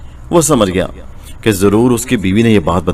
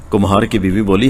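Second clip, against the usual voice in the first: usual speaking voice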